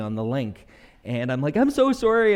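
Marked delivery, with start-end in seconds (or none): 1.6-2.3 higher, louder pleading apology; imitating a tearful excuse; mock tearful apology. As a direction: plead with the officer, hammed-up. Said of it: "and I'm crying".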